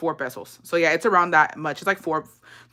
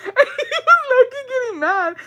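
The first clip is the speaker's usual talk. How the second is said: laughing through words; 4 dB louder than usual. Real, not acted. Laughing while telling.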